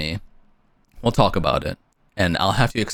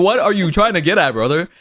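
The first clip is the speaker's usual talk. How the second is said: high, loud sarcastic challenge; mock bro retort; 9 semitones higher, 4 dB louder than usual. To the guy accusing her, a high mock challenge. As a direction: jab back at the guy.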